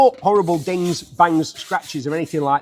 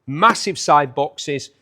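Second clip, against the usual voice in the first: ordinary talk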